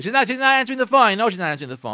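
loud protest, jumping high at first; a touch of exasperated objection; mock indignant husband. Scoffing husband about his wife not answering, indignant.

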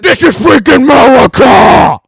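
loud, high "America!"; patriotic shout. Pretend. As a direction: bellow it like a proud patriot. Mock patriotic yell.

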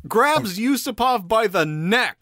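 loud, rising, stretched-out storytelling; dramatic reveal. Real, not acted. Storyteller revealing Rasputin leaping from the floor, loud drama. Builds to story's shock twist.

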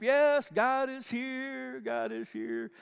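imitating rapt worship, long held words. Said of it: "I'm just into worship".

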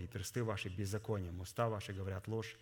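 ordinary talk

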